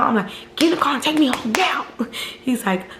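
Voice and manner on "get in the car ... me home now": higher, demanding order to get in; angry order. Retold or imitated. Ordering her ex into the car, mock angry demand. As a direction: bark the order at him.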